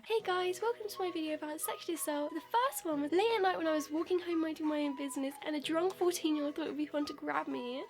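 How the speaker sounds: high, perky parody YouTuber greeting; mock vlogger intro. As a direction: put on a fake cheery host voice. Parody of cheery video intro.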